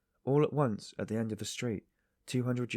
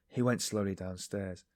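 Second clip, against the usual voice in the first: ordinary delivery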